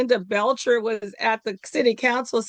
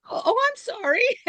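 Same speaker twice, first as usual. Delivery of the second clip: much higher-pitched mock apology; imitated flustered apology; 14 semitones higher than usual. Local people backtracking when she turns around and responds, imitated flustered apology. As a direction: put on a high, embarrassed "oops" voice. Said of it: "they're like".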